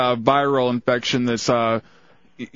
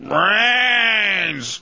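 zombie; 5 semitones higher than usual. Pretend. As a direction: groan it like a hungry zombie. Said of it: "if they want brains, they'll".

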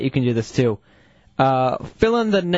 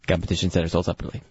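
fast ad disclaimer; 8 semitones lower, 4 dB quieter, much faster than usual. Retold or imitated. Mocking toy ad disclaimer.

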